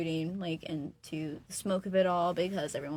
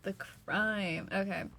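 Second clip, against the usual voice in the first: ordinary delivery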